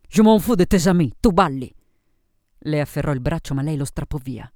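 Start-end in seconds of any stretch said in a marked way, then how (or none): 0.1-1.7 loud, rough demand; pushy suitor losing patience; angry insistence. Acted. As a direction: order her onto the floor. Man angrily insists on dancing.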